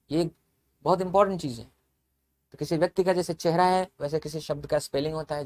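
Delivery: ordinary talk, nothing marked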